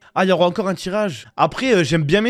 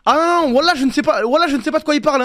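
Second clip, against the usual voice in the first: high, raised-voice denial; mock protest; 6 semitones higher, 3 dB louder than usual. Streamer denying he knows the leak, mock protest. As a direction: protest innocence, hammed-up. Play-acts innocence about spoiling.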